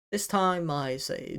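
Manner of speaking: ordinary talk, nothing marked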